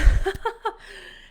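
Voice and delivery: loud, high-pitched laugh. Real, not acted. Laughs while hyping the audience.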